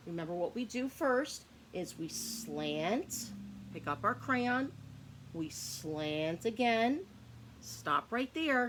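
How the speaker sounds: slow, deliberate letter-writing steps; walk them through each stroke slowly; slow step-by-step instruction. Shown as a demonstration. Teacher slowly guides kids writing a Y. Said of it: "show you again how we make".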